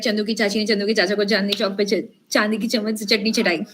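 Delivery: quick-fire tongue-twister recitation; rapid tongue twister. Shown as a demonstration. Coach showing students a Hindi tongue twister, rattled off fast. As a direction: rattle it off fast, in one run.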